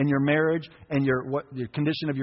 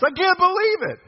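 very high squeal of disbelief; mock amazement; 5 dB louder than usual. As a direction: squeal like a stunned believer. Mimicking amazed believer.

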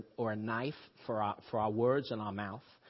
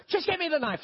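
much higher and louder, fed up; exasperated raised voice at the son; exasperated order; 4 dB louder, faster than usual. Retold or imitated. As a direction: snap it out as the fed-up father. Losing patience with son at table.

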